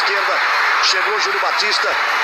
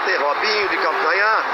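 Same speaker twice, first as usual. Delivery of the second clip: ordinary talk, nothing marked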